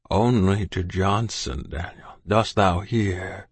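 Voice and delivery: slow, breathy half-whisper; whispery. Acted. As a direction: whisper it with your last strength. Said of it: "all whispery".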